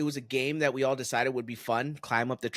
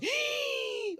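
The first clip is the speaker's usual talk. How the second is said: high inhaled shriek of shock; shocked gasp; 4 dB quieter than usual. Retold or imitated. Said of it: "they inhale and make that sound".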